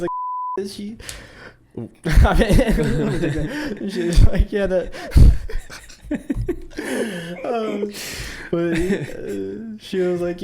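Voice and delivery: words broken by laughter; can't stop laughing while talking. Real, not acted. Trainer cracking up retelling his recruit's panic, laughing.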